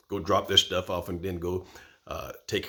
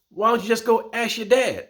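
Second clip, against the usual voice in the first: high, louder protesting friend; friend's protest; 7 dB louder than usual. Retold or imitated. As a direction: imitate Gary pushing back. Gary's retorted question.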